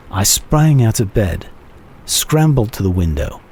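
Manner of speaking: ordinary delivery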